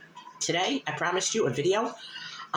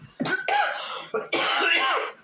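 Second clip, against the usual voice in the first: a dry, rasping cough fit. Real, not acted. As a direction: cough hard, mid-sentence. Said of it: "my body's just so used to coughing".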